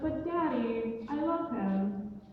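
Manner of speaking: slow, drawn-out plea to dad; acted lovesick girl begging father; pleading daughter. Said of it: "And the daughter's like".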